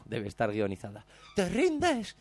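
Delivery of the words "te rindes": high shouted challenge. Retold or imitated. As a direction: yell it down the street at him. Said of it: "gritándole por las calles". (grito desafiante imitado)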